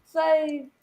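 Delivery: loud, high shout at the chance; excited shout. Real, not acted. Fan watching Ronaldo's chance, shouting excitedly. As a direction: yell it at the screen. Reacting to live match chance.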